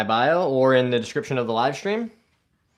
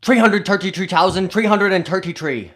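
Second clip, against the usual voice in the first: higher, louder put-on Irish accent; 7 semitones higher, 7 dB louder than usual. Shown as a demonstration. Streamer has a viewer rate it, Irish accent. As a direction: show off your Irish accent on one sentence. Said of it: "to rate my Irish accent".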